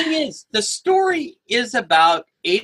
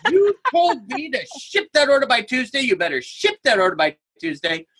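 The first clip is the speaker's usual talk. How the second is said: mock indignant demand; 5 semitones higher than usual. Mock argument butting into stranger's call.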